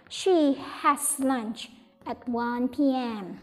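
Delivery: ordinary talk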